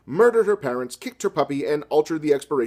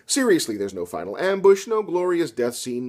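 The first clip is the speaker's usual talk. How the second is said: normal talking voice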